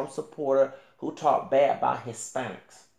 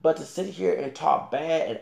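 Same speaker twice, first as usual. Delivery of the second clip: usual speaking voice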